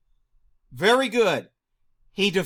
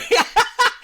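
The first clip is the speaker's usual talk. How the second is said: high loud burst of laughter; high delighted laugh; 6 dB louder than usual. Real, not acted.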